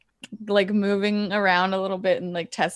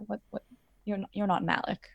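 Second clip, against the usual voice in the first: hushed confusion; 8 dB quieter, faster than usual. Acted. Prisoner realising it's not Malik, hushed and confused. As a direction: say it very softly, unsure.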